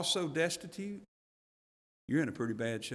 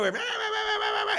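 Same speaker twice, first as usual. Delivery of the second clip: imitated kids' high rah-rah racket; kids' noisy racket. Noisy young kids playing, imitated in a high yelp. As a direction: mimic the children's din, high pitched. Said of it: "The kids are".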